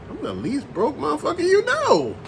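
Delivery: high, mocking boast; cocky retort. Answering people who call him broke, with a high cocky scoff. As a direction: toss it back high and scornful.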